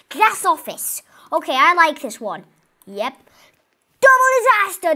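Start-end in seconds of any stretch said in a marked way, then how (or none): 4.0-4.9 disaster movie extra. Pretend. Natural disaster hits in game.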